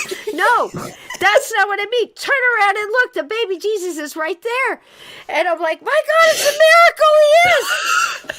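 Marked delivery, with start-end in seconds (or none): 0.3-4.8 loud, high mock yell of the brother; imitated shout. Brother shouting to turn around. 5.8-8.3 shrieking, very high and loud amazement; mock ecstatic cry. Overjoyed at finding baby Jesus.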